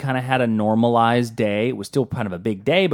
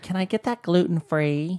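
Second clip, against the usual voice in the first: higher, softer mock customer voice; fussy customer; 6 semitones higher, 4 dB quieter than usual. Imitating a diner at a sandwich shop, high mock voice. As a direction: ask it in a small, high voice.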